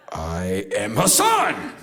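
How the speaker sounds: slow declaration swelling to a shout; mystical proclamation. Acted. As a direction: proclaim it slowly and grandly, loud. Acted mystical self-proclamation.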